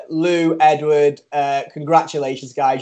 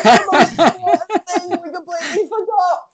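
laughing shout. Real, not acted. Realising they forgot the news.

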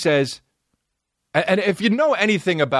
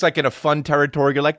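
ordinary talk

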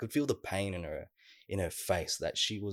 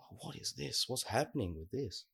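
higher-pitched, softened puzzlement; retold bewilderment; 5 semitones higher, 3 dB quieter than usual. Storyteller recalls seeing a scooter wobbling at them, bewildered. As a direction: wonder aloud in a high, hushed voice.

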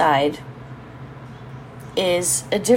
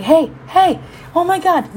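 much higher, thrilled "hey, hey, oh my God"; excited stargazing; 10 semitones higher than usual. Retold or imitated. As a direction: spot a constellation and squeal a little. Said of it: "I do that with stars".

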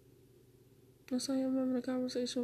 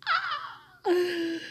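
very high, strained wail; loud, high cry of despair; real distress; 6 dB louder, much slower than usual. Real, not acted. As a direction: voice shoots way up in anguish. Breakdown, fears ending in mental hospital.

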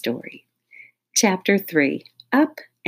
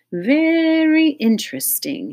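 high, slow musing mutter; a curious doctor murmurs to himself; hammed-up pondering psychologist; 11 semitones higher, 6 dB louder than usual. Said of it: "he muttered".

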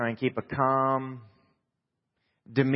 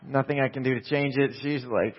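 normal talking voice

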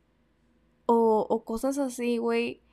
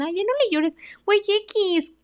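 mocking quote; 9 semitones higher, 4 dB louder than usual. Mocking people who say stop crying.

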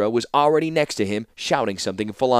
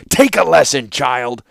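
loud, higher, scornful order; threatening reply; 3 semitones higher, 7 dB louder, slower than usual. A narrator's reading. Warlord about to teach the young ruler a lesson, scornful. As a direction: put the upstart down hard. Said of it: "replied the warlord".

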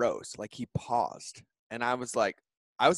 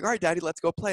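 put-on higher, louder, childlike and eager; little son's voice; 8 semitones higher, 6 dB louder, faster than usual. Son asking daddy to go play, bright little kid voice.

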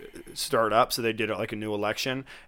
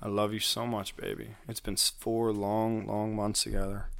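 sweet nothings; 6 dB quieter than usual. Pretend. Mock farewell to a lover.

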